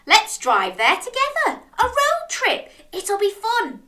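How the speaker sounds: high, loud, eager crab; beaming excitement. A narrator's reading. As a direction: propose it with bubbling excitement. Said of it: "beamed Crab".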